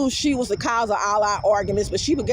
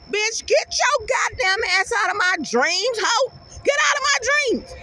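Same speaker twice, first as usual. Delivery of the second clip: angry yelling; 9 semitones higher than usual. Pretend. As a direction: chase the witch out with a high wail. Yelling at witches in her dreams.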